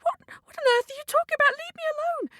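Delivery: very high, panicked cockerel voice; protesting cockerel. Acted. Cockerel to the man chasing him, flustered protest. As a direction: squawk back in a high-pitched fluster.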